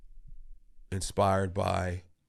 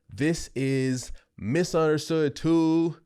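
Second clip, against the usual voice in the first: hype announcement; 7 semitones higher, 5 dB louder, slower than usual. Real, not acted. Announcing his album title.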